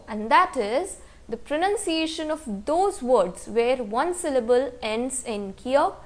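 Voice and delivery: normal talking voice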